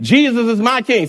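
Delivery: said like you mean it. Shown as a demonstration. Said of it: "say it like you mean it".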